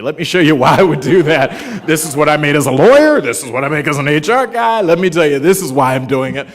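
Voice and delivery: eager explaining. Retold or imitated. Retold pleading with grandmother.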